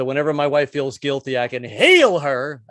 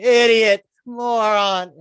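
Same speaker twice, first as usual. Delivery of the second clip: loud jeering insults; passing hecklers; 7 semitones higher, 6 dB louder, much slower than usual. Acted. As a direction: yell out of a car window. Drivers heckling him.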